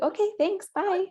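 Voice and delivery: high-pitched, quick polite goodbye; imitated sing-song sign-off; mock-polite brush-off. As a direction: chirp a fake-polite dismissal. Acting out brush-off to condescending midwife.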